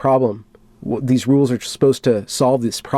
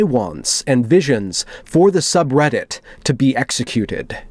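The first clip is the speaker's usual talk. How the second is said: dramatic reading; 4 semitones higher than usual. Acted. Reading a moderator's manifesto to viewers, solemn and dramatic. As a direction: read it like a grave confession.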